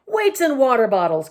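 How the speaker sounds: loud, high exasperated outburst; indignant yelp of annoyance; angry voice. A narrator's reading. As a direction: burst out crossly like a curse. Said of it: "came an angry voice".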